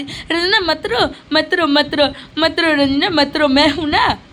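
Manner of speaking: hammed-up "there, there" to a crying wife; mock consoling husband. Husband soothing his tearful wife, mocking imitation. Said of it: "बीबी आ गई रो के थोड़ा सा आंसू दिखा दी".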